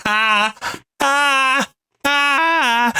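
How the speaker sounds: high mock pain scream; repeated high wailing "ah", imitating agony; ridiculous scream. Mechanic pinned by a car, ridiculous imitated scream. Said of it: "he does this fucking ridiculous scream".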